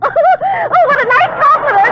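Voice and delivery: high, giggling gush of delight; giggly high flattered gush; laughing delight. Acted.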